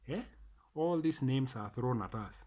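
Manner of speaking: usual speaking voice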